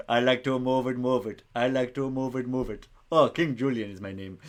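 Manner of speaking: put-on cartoon king accent, punchy sing-song chant; King Julian accent. Quiz host riffing on Madagascar, hammed-up King Julian accent. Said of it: "I'm gonna stop doing that accent".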